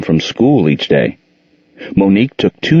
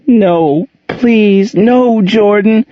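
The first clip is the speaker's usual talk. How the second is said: much higher, louder, slow desperate pleading; little girl begging her stepfather to leave her alone; frightened begging; 4 dB louder, much slower than usual. A narrator's reading. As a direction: beg him to stop, terrified. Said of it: "she begged".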